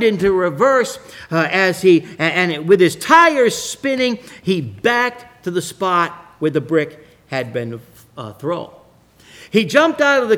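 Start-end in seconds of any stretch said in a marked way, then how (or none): none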